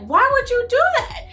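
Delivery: high, shrill-edged disbelief at him; shocked question. Retold or imitated. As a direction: ask it like you just found the mess. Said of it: "She's just like".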